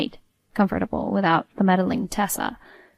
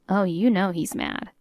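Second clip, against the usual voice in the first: plain reading voice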